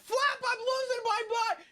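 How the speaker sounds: high-pitched mock panic; imitating a frantic buddy yelling for help; Pred's panicked yell. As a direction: squeal it like a panicking friend. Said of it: "I see Pred and he's like".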